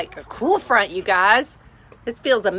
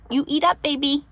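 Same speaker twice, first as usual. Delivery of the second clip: put-on baby talk; 6 semitones higher than usual. Cooing to the animal.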